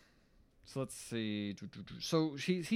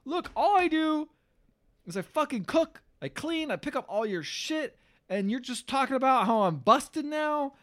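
mock upset plea; 11 semitones higher than usual. Adrian upset with Paulie.

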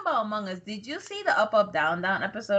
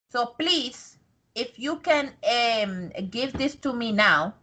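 put-on halting, broken-English immigrant accent; immigrant parent accent. Mom asking officials for food stamps, careful immigrant accent. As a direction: search for each word in a parent's accent.

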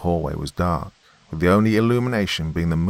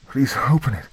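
desperate plea; 8 semitones higher than usual. A narrator's reading. Woman begging at door.

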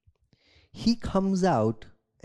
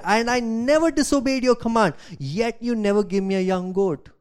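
resentful protest; 7 semitones higher, 5 dB louder than usual. Acted. Older son shouts grievance at his father, indignant. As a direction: throw the grievance at him.

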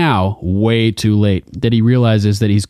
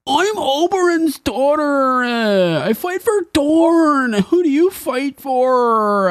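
much higher, slow mock-heroic voice; whiny high fighter girl; mock fierce girl; slower than usual. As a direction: play a squeaky would-be warrior daughter. Acting a Sand Snake's line.

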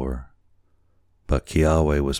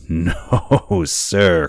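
put-on louder, higher drunken refusal; loud boozy refusal; drunken cry; 4 semitones higher, 6 dB louder than usual. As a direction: cry it out like a tipsy sailor. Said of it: "cried the boatswain".

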